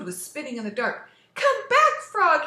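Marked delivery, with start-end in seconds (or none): 1.4-2.4 very high, loud plea; desperate shout. Acted. Toad begs Frog to return, loud high shout. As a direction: shout to call him back. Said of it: "he shouted".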